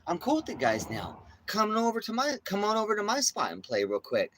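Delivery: high, sly voice for the other dog; mock the dog playing innocent; imitating the dog. Voicing the pit bull pretending to be friendly, sly and high. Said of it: "he's like".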